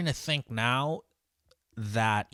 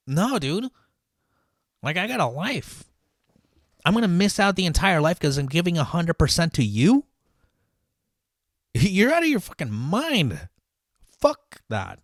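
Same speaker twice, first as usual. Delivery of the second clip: indignant rant; 7 semitones higher, 6 dB louder than usual. Real, not acted. Ranting about losing family time.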